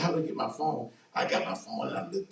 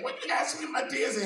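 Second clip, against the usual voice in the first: high, incredulous outburst; how can you even ask that; exasperated; 11 semitones higher than usual. Retold or imitated. Man retorting to his wife while sick, exasperated.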